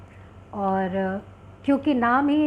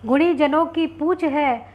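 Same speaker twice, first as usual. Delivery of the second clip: slow, raised-pitch couplet recital; poem recitation; 4 semitones higher, 4 dB louder, slower than usual. Acted. Poet presents a doha to online audience, reciting slowly. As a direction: declaim the doha slowly, each line falling at its end.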